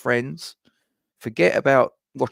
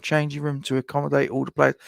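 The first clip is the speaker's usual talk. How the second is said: ordinary talk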